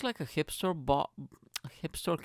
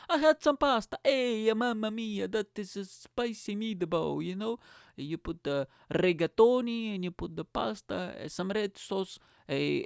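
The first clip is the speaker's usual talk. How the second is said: mock Italian chef, much higher, mamma mia style; hammed-up Italian; 7 semitones higher than usual. Joking about pasta to podcast listeners, hammed-up Italian accent. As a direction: ham up an Italian cook, kiss the fingers at the end.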